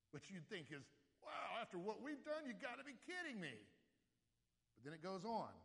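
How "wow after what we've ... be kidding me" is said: high, louder astonished "you gotta be kidding"; disbelief. Retold or imitated. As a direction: voice their stunned disbelief. Imagined disbelief at God's mercy.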